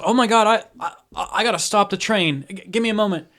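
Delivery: high, loud, stammering panic; panicked stewardess. Acted. Stewardess rushing to stop the train, panicked. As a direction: blurt it out, tripping over the words.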